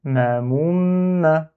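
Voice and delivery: "Ma moum, ma" with the m of "moum" held long; syllable reading. Shown as a demonstration. Teacher repeats the word for learners, drawn-out "Ma moum, ma". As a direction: sustain the doubled m before the last "ma". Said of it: "Donc on lit".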